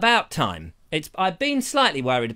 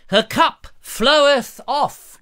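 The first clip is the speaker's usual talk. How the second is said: slow, loud, raised grand delivery; mock declamation; 9 semitones higher, 8 dB louder, much slower than usual. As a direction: proclaim it like mock scripture.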